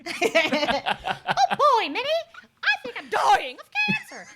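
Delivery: high cartoon mouse squeak; high mouse voice close to laughing; Mickey Mouse. Cracking a dark joke to Minnie, high mouse impression. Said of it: "the Mickey and Minnie Mouse voice".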